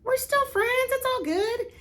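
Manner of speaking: high, carefree "we're still friends"; breezy reassuring friend. Retold or imitated. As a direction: pitch it way up and wave it off cheerfully. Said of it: "you're like".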